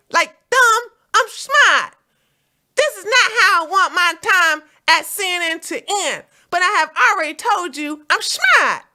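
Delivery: Fredo voice. Retold or imitated. Streamer voicing Cuomo as Fredo, loud insistent whine. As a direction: plead that you're smart like Fredo. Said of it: "That's not how Fredo talk".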